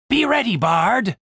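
tense, drawn-out command; urgent warning. Acted. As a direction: warn a partner just before the move. Half-elf warns before the crash.

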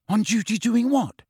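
quick, high-pitched question; quickly. A narrator's reading. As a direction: jump in eagerly with the question. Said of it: "said Ron quickly".

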